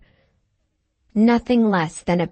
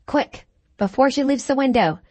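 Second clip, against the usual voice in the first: higher, urgent order; urgent shout; 6 semitones higher than usual. A narrator's reading. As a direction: hurry the men along. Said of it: "he shouted".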